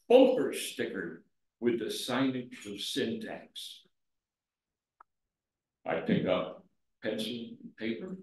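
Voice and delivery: declaimed poem, opening high and drawn out, then easing down; poem recitation. Acted. Poet reciting "I Am" to the audience, measured poetry recitation.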